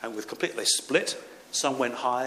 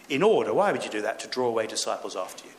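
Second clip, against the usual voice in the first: normal talking voice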